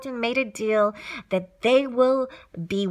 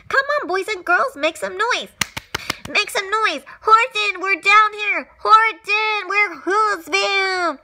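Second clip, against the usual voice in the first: high, urgent hollering; calling out; 7 semitones higher than usual. A narrator's reading. Tiny townspeople rallying and calling up to Horton, high urgent cries. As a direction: holler high to be heard from afar.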